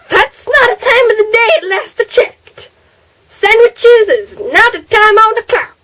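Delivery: high, incredulous correction; mock indignant retort. Vlogger retorting to mom, high mock disbelief. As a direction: act baffled at the silly answer. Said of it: "And I'm like".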